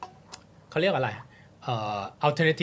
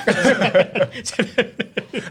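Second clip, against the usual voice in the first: a burst of real high laughter; loud laugh; 7 semitones higher, 7 dB louder than usual. Real, not acted. Laughing hard at his own story about the teacher.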